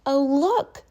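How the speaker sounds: high, bright class chorus; excited kids. Acted. Class cheers as the door opens, high and excited. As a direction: kids spotting their teacher. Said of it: "said the class".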